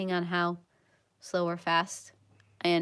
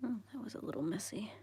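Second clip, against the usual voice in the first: whispering; 2 semitones higher, 14 dB quieter than usual. Real, not acted. Whispered coloring stream chat.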